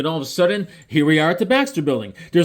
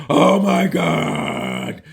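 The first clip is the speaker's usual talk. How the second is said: much lower, very slow and drawn out; slow deep awe; 12 semitones lower, much slower than usual. Retold or imitated. The Human Torch stunned by Galactus, deep slow awe. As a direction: let it fall out low and slow.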